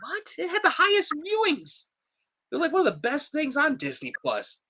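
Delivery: high-pitched, indignant disbelief; retold outburst of baffled protest; incredulous protest. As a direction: squeal it in mock outrage. Retells his disbelief at cancellation.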